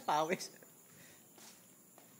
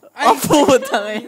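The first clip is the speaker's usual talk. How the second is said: slow, loud, laughing curse; laughing shout; 8 semitones higher, 20 dB louder, much slower than usual. Real, not acted. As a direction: belt it out through laughter. Friend's misstep, sudden reaction.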